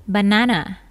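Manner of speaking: British "banana", middle vowel a long "ah"; British pronunciation. Shown as a demonstration. Teacher giving the word for pisang, British "banana" with "ah". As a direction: say "banana" as ba-NAH-na. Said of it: "jika dalam aksen British".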